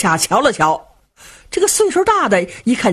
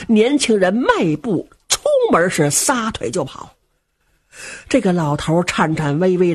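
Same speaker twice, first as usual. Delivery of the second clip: ordinary delivery, nothing marked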